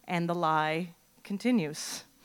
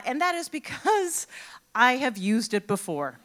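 ordinary delivery

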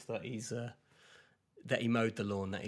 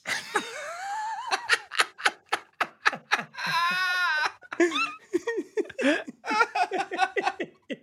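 high loud laughter breaking into tears; real laughing fit at own story, high and loud; laughing till near crying; long helpless laugh; 10 dB louder than usual. Real, not acted.

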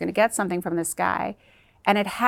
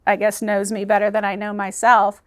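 ordinary delivery

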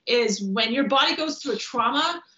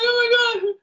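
mock sob; 11 semitones higher, 5 dB louder, slower than usual. Retelling crying in the corner.

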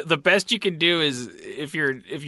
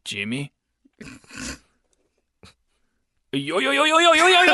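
ramp the yos up into a big callback; New Zealand guy callback; 10 semitones higher, faster than usual. Acted. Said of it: "That was a callback".

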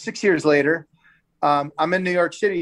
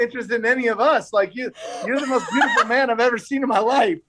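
pitched up, laughing through the praise; gushing compliment; 6 semitones higher, faster than usual. Retold or imitated. TJ praising his friend's looks, pitched-up gushing with laughter. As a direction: gush over him with a laugh.

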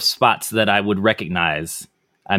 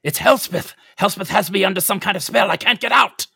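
high, strained plea for help; acted distressed reply; 11 semitones higher than usual. Player answers from under a spell, distressed high plea. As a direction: pitch climbing as a trapped character. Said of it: "I respond, uh".

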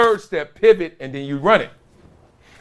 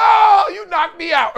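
high, slow, shouted realization; sudden loud realization, drawn out; surprised shout; 4 dB louder than usual. Retold or imitated. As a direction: yell it out as it finally dawns on you. Realizing the linebacker who hit him.

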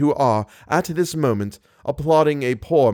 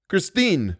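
higher call to her by name; crying out; 6 semitones higher than usual. A narrator's reading. Narrator cries out to Christine, anxious call. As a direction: cry out the name, pitched up. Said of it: "I cried".

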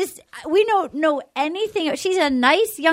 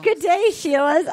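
put-on Australian accent; 5 semitones higher than usual. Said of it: "talking in an accent".

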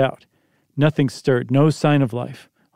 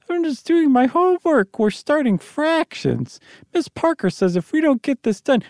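put-on boy's voice; 11 semitones higher than usual. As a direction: protest like a kid with homework. Tommy's voice in the story.

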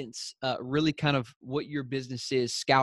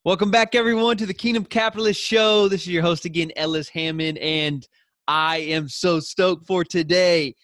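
much higher and louder, bursting with energy; hyped welcome; 5 semitones higher, 7 dB louder than usual. Acted. Host welcoming listeners back, pumped announcer energy. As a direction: open the show like you can't wait. Host's hyped show opening.

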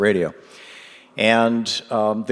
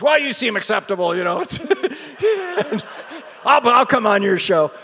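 high imitation of a gruff but sweet old man; grudging approval, grumpy but kind; crotchety old man; 13 semitones higher than usual. Bernie tells the host he'll come on the show. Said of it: "kinda crotchety old man".

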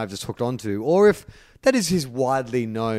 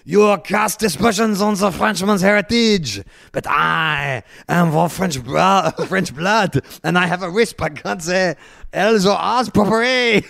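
hammed-up French accent, high and loud, with a put-on lisp; overdone French outrage, slow and theatrical; over-the-top French accent with a comic lisp; Mark Lacroix French accent; 5 semitones higher, 4 dB louder than usual. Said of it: "going into two racist accents now".

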